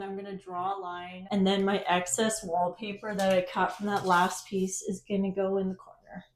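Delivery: ordinary delivery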